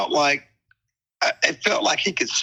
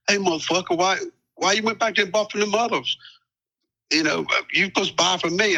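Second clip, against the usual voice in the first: raised, higher voice, scolding the buyer; hey what's wrong with you, pitched up; angry confrontation; 6 semitones higher than usual. Retold or imitated. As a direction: dealer scolding him for buying elsewhere, angry. Robber confronting him in car.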